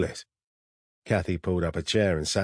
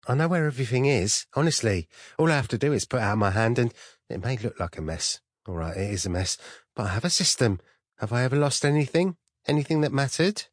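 put-on higher-pitched, eager young assistant protesting; defensive cheery secretary; 5 semitones higher than usual. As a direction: insist you have everything under control. Narrator voices Kathy, the cheery secretary.

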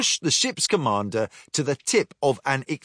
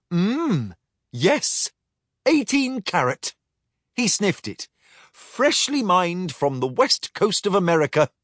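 higher prim robot voice; 6 semitones higher than usual. Acted. Quartermaster answers the captain primly, higher voice. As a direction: say it primly and precisely. Lexi assesses gold.

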